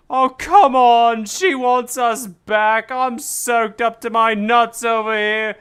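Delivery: drenched and cold. Acted. Wife complains about being soaked.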